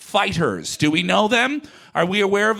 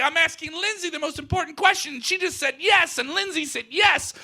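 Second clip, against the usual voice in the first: elated announcement; 7 semitones higher than usual. Acted. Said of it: "And I say".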